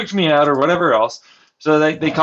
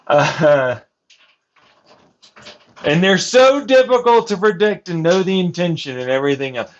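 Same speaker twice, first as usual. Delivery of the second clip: usual speaking voice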